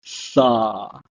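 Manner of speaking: Sa with a clear s sound; Hebrew pronunciation. Shown as a demonstration. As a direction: say Sa with s, not Sha. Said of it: "so it's pronounced as".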